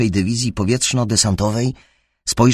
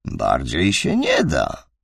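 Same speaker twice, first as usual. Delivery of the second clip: calm; 7 semitones lower, slower than usual. A narrator's reading. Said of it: "odpowiedział spokojnie".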